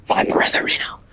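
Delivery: spooky unvoiced whisper. Naming the scary boss, whispering. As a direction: breathe the name like a secret.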